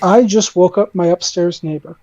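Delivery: ordinary talk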